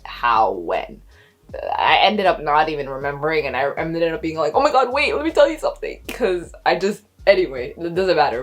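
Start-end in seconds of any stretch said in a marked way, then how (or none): 4.6-6.0 high, louder, urgent interruption; excited blurting. Retold or imitated. Woman bursting to tell her partner news, excited blurting. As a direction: blurt it out, pitch jumping up.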